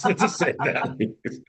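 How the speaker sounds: laughing through the words; amused, words broken by laughter. Real, not acted. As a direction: chuckle as you finish the punchline. Laughs at his own punchline.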